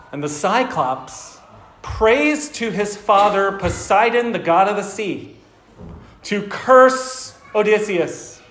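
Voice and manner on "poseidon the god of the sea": ordinary talk, nothing marked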